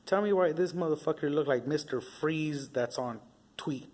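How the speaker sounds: usual speaking voice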